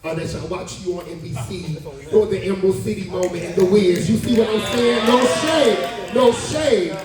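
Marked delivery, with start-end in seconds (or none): 2.1-7.0 louder and higher, hyping them up; excited hype. Real, not acted. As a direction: gush with building excitement. Hyped praise, repeated catchphrase.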